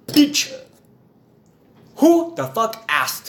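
high, loud, slow mock Nigerian put-down; accented retort. Man in the waiting room snaps at the woman, high and loud. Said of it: "And he goes, he goes".

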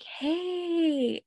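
high, forced-bright greeting; a strained fake-cheerful hi; awkward hey. Greeting the ex she avoided, forced high brightness. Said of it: "I was like".

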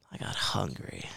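a hushed whisper. Real, not acted. Whispering to his friend about the sudden munchies. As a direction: drop to a whisper, like sharing a secret.

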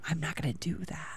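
breathy whisper, barely voiced. Real, not acted. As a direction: whisper it to yourself.

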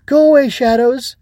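put-on high, loud child's scream. A narrator's reading. Ava protects the Growbug from shadows, screaming. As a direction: scream to drive them off. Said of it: "and screamed out".

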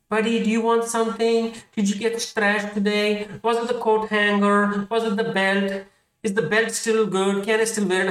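high, put-on gentle father voice; high-pitched mock sympathy; concerned dad. Voices gentle dad checking on child.

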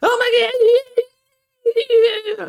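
very high, loud squeal; shrill high mock boy squeal; little boy voice. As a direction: squeal it like a small cartoon kid. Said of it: "He's just like".

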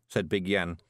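ordinary delivery

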